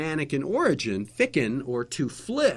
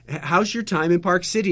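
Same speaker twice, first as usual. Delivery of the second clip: lightly acted, raised interviewer voice; TV host question; 6 semitones higher, 7 dB louder than usual. Interviewer asking the German director about his visit, lightly acted host voice. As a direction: put the question to the guest, louder and higher.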